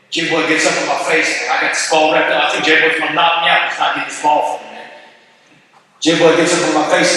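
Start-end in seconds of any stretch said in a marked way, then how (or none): none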